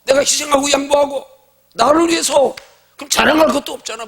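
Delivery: loud, high full-force sermon; impassioned preacher's climax; fiery preaching. Acted. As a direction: drive it home to the congregation.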